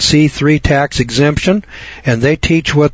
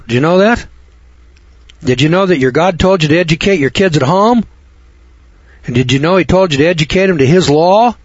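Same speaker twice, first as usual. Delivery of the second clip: fiery preaching; 5 semitones higher than usual. Acted. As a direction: drive the point home like a preacher. Heated preaching returns.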